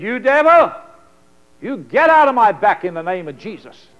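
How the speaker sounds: retold shout at the devil. Wife on the stairs ordering the devil out, loud shout. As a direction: yell it like casting the devil out. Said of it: "she started shouting at the devil".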